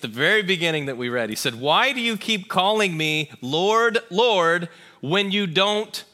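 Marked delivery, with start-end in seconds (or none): 1.6-6.0 loud, slow preaching quote with punched words; emphatic challenge. Acted. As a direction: challenge them slowly and forcefully.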